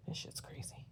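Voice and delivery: cursing in a whisper. Real, not acted. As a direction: breathe it out as a secret. Cursing aside under breath.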